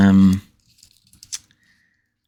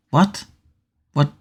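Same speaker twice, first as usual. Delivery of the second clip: baffled what; 7 semitones higher than usual. Real, not acted. Baffled by chat message.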